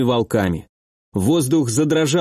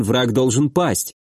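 usual speaking voice

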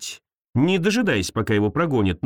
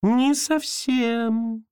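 high, slow, drawn-out ghostly tone; eerie ghost voice; 11 semitones higher, much slower than usual. Acted. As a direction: stretch it like a spooky spirit. Hidden ghost answers from under bed.

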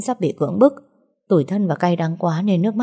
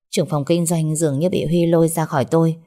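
usual speaking voice

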